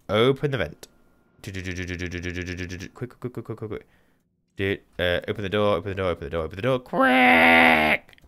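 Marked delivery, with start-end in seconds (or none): 1.4-3.9 soft, rapid-fire repeated syllables; hurried chatter. Real, not acted. Hurrying through the airlock. 6.8-8.2 long, very high loud shriek; frantic scream. Real, not acted. Screaming at slow door.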